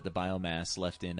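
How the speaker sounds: normal talking voice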